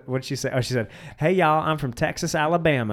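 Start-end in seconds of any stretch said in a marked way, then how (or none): 1.2-2.9 imitated Texas twang; mock Southern American accent. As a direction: put on a bad Southern US accent. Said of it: "I have another American accent".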